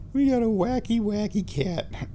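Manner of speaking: high singsong kitty teasing; pet talk. Real, not acted. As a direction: tease the cat in a high pitch. Playful talk about the cat.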